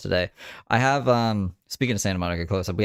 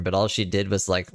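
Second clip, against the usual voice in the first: ordinary talk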